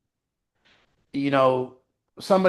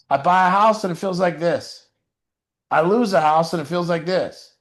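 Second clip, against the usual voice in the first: normal talking voice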